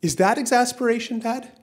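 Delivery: put-on high-pitched child's question; light, high, questioning son; curious kid. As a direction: voice a little boy asking his dad. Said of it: "And the kid says".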